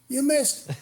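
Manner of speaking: high-pitched "told you" complaint; mock-indignant high jab; retold reproach. Retold complaint after second miss.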